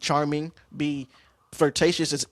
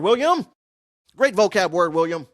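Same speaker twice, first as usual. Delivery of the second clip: louder, higher teasing cheer; mock self-praise; 5 semitones higher, 7 dB louder than usual. Praising himself for using a vocab word, mock cheer. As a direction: hype himself up playfully. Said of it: "Oh my gosh, great vocab word".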